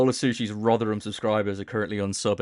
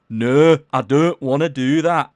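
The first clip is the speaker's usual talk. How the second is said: loud, higher exaggerated Rotherham accent; mock Rotherham accent; 5 semitones higher, 12 dB louder, slower than usual. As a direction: mimic a horrendous Rotherham accent at full volume. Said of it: "some pretty horrendous Rotherham accents".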